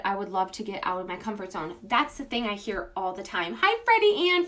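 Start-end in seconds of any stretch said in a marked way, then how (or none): 3.6-4.5 excited greeting. Real, not acted. Greeting a favorite customer live.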